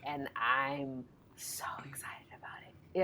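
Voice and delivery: breathy excited whisper. Real, not acted. Gushing about her new deck.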